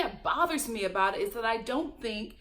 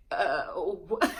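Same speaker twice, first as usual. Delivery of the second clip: low, dumbfounded mumble; mock confused; 6 semitones lower than usual. Host imitating the man's reaction to her breakdown, confused. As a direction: imitate a baffled man, pitched down. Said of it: "He was so confused".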